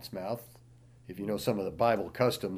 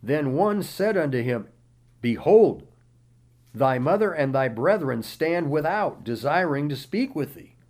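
raised, declaiming Bible reading voice; scripture reading; 4 semitones higher, 6 dB louder than usual. Acted. Pastor reading Matthew aloud to the congregation, declaiming. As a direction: read the verses out like a preacher in full flow.

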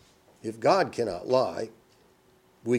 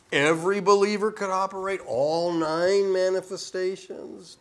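mocking doubt; 8 semitones higher, 5 dB louder than usual. Mocking doubt in serpent's words.

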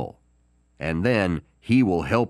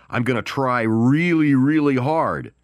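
louder, hammed-up "I'm gonna try really, really hard"; mock earnest promise; 3 semitones higher, 4 dB louder than usual. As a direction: overplay a half-committed promiser. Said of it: "even the phrase".

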